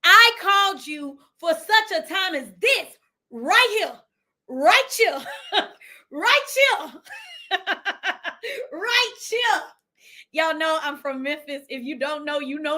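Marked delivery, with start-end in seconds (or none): 0.0-3.0 voice of God. Retold or imitated. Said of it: "God said". 3.3-9.6 higher, laughing "right here" called out; laughing shout. Retold or imitated. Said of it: "God said".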